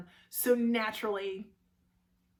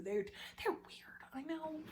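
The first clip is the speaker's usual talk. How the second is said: whisper; 4 semitones higher, 14 dB quieter, slower than usual. Real, not acted. Aside about in-laws.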